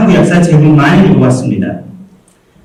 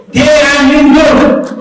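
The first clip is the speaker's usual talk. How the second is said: slow, high, drawn-out rallying cry; rousing exclamation; 7 semitones higher, much slower than usual. Acted. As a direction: proclaim it proudly to the crowd. Speech climax, rousing cry.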